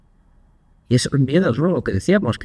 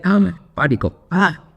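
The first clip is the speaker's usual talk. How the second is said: mock panic; 6 semitones higher, slower than usual. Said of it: "la sensación era de".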